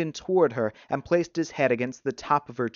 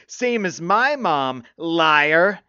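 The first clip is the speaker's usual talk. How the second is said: higher, slow, loud accusation; furious agreement; 5 semitones higher, 7 dB louder, much slower than usual. A narrator's reading. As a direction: build to a loud, jeering "liar". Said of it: "nodded his head furiously".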